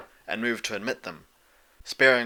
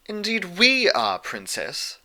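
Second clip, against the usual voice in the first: higher, refined lady voice; prim lady voice; 9 semitones higher, slower than usual. A narrator's reading. Rarity voiced, high.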